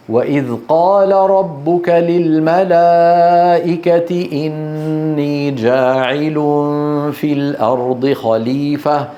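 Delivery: medium-pace Quran recitation. Shown as a demonstration. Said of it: "هذه أسرع".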